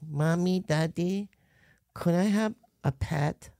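put-on high, soft, slow child asking parents; little kid voice. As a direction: ask sweetly like an eight-year-old. Retelling himself as a kid.

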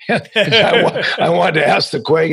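breaking into a laugh mid-sentence; words squeezed out through laughter. Real, not acted. Laughs while telling story.

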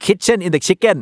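learner's mix-up. Acted. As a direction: pitch it up like a keen learner blurting it. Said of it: "บางคนก็จะพูดไก่อยู่ในครัว".

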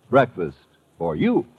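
usual speaking voice

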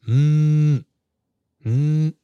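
hummed "mm, mm" like a phone buzzing. Retold or imitated. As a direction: imitate the phone vibrating with two hums. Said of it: "my phone goes".

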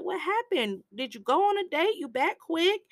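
high, teasing questions; curious questioning. Retold or imitated. Sonny quizzing Caladro about the date, high and teasing. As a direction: poke at him with questions.

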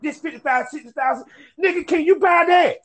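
high-pitched boasting film character; movie-line impression. Host quoting his favorite line from the Mac, high boastful impression. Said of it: "My favorite line when my boy would break it down".

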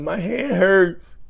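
high, slow, whining fake hurt; mock a crybaby abuser nursing his fist; whiny mock victim. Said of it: "they're like".

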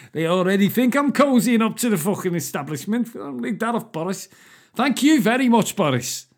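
high, loud mock-Scouse outburst; sarcastic, high-pitched Scouse outrage; exasperated Scouser. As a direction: protest indignantly in a hammy Liverpool accent. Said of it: "That must mean what he was thinking".

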